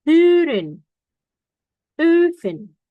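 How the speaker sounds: "Huren", "Oven" with pouted O-E vowel; O-umlaut in words. Shown as a demonstration. Teacher giving example words, stressed O-umlaut vowel. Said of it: "jadi kayak agak monyong".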